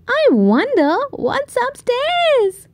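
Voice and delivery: put-on high curious little-girl voice; Goldilocks. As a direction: sound like a nosy little girl. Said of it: "she said".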